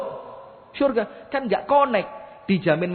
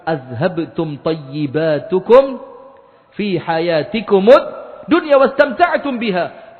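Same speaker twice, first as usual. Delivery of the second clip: recited verse rising; 6 dB louder, slower than usual. Acted. Preacher recites the verse to the crowd, building slowly to loud. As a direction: start measured, then swell loud on the last words.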